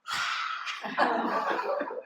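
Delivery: forceful voiceless exhale as a warm-up; whooshing exhale, breaking into a laugh; lion's breath. Shown as a demonstration. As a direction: demonstrate the yoga master's big breath out. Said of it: "warm-ups like this".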